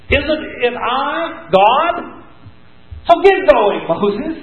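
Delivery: a fed-up God demanding who made the mouth; irritated God. Acted. God irritated with Moses, loudly scolding him. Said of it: "he's getting a little irritated with Moses".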